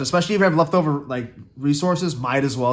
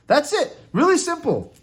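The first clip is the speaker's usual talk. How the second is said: upbeat high; 13 semitones higher than usual. Real, not acted. Upbeat wrap-up.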